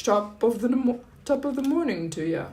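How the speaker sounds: hammed-up top of the morning accent; mock Irish-British accent. Answering a viewer in a playful mock British accent. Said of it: "Was that a British accent?".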